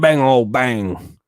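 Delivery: slow, drawn-out hillbilly imitation; mock Appalachian accent. Mocking how hill people talk, in a slow Appalachian drawl. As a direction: mimic the hill people slowly.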